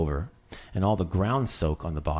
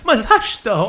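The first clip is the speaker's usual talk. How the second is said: high, loud indignant why; mock outrage; 13 semitones higher, 11 dB louder, slower than usual. Driver after a fender bender, loud mock outrage. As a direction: demand it like an aggrieved driver.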